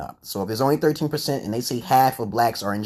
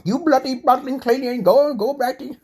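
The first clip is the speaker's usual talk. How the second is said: high, loud mock British accent; hammed-up, badly faked British accent; 14 semitones higher, 4 dB louder than usual. Streamer mocking how British people talk, bad high-pitched British accent. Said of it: "I can't imitate their accent at all".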